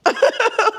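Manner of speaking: high embarrassed giggle; shy burst of laughter; awkward giggle. Real, not acted. Awkward on camera with Matthew.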